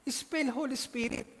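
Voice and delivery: repeating the order, amused and slow; laughing retelling. Retold or imitated. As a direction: chuckle through the consul's demand.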